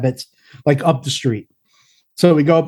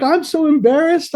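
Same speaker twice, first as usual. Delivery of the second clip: much higher sheepish voice; embarrassed woman; 11 semitones higher than usual. Retold or imitated. Neighbor admitting embarrassment, high and sheepish. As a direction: squeak out the embarrassment. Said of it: "She's like".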